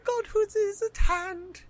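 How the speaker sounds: slow, hammed-up villain proclamation; imagined villain voice. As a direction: declaim it slowly like a cartoon villain. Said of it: "What if he talked that way?".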